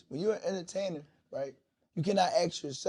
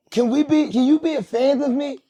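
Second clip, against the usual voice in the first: loud mocking quote; 8 semitones higher, 9 dB louder than usual. Quoting entertainer, raised.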